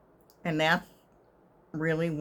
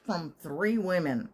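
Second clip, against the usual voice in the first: ordinary talk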